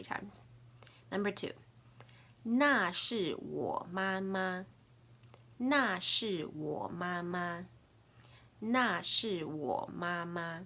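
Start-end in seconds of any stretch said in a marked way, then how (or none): 2.0-10.6 slow, deliberate Mandarin for learners; teacher's drawn-out demonstration; slow language model. Shown as a demonstration. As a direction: model each word slowly and distinctly. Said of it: "say it three more times".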